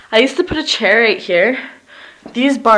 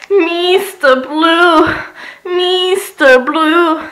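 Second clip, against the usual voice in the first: sing-song announcing; 6 semitones higher, 8 dB louder, much slower than usual. Real, not acted. Drawn-out announcing of bathroom color.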